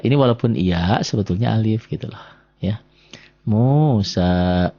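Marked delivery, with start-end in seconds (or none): 3.5-4.7 Musa with both vowels drawn out; slow model reading of Musa, held long. Shown as a demonstration. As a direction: stretch the final a of Musa. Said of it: "di sini jadi panjang".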